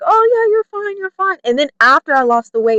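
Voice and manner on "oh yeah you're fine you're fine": high-pitched mock reassurance; high imitation of breezy placating; placating family. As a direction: mimic relatives waving it away, pitched way up. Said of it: "they'd be like".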